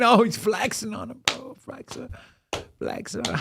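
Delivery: words breaking into soft laughter; laughing. Real, not acted. Laughing at guest.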